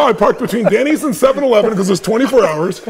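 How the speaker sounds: normal talking voice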